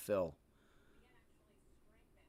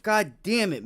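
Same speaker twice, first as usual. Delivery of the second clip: angry shout; 9 semitones higher, 10 dB louder than usual. Real, not acted. Cursing a missed trade.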